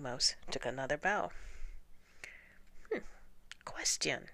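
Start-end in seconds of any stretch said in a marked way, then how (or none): 2.9-4.3 frail murmur from the back; weak voice. A narrator's reading. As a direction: say it barely there, timidly. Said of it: "said a weak voice".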